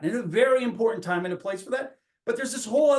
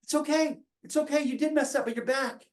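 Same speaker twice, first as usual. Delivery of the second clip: lightly raised, gentle comforting voice; a father welcoming his lost son back, mildly soothing; reassuring dad; 7 semitones higher, faster than usual. Retold or imitated. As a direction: soothe the son home, a hint of a tender father. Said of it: "the dad's like".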